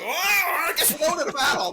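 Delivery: high, fast, dopey soldier voice; mock confused soldier. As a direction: blurt it out like a clueless soldier running off. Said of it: "they all start running about like".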